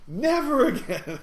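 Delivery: high, loud and drawn out, breaking into laughter; slow, wailed joking protest; mock outrage. Shaken passenger repeats his vow, laughing mock outrage.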